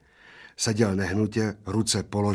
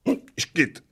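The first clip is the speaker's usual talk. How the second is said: higher, spluttered hiccup; hiccup burst; 8 semitones higher than usual. A narrator's reading. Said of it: "vyprskl maličký". (vyprsknuté škytnutí)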